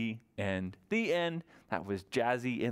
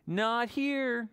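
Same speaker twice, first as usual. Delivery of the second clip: bird voice; 9 semitones higher than usual. Acted. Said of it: "says Curly Whirly Bird".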